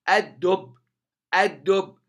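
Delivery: "الدب" loud, as ad-dubb, lam dropped; doubled dal. Shown as a demonstration. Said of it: "وتشدد الدال". ("الدب" بتشديد الدال)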